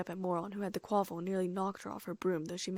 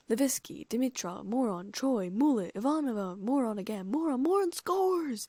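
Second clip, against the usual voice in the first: excited commentator; 4 semitones higher, 5 dB louder than usual. A narrator's reading. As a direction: call the names, then soar on the score. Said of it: "Bagman announced".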